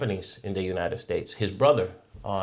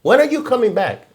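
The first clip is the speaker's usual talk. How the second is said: eager; 12 semitones higher, 6 dB louder than usual. Retold or imitated. Retold eager question.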